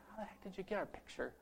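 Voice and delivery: very soft, muttered aside; hushed puzzlement. Retold or imitated. Visitor spotting his own picture in the boy's room, hushed puzzlement. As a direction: drop right down under your breath, baffled.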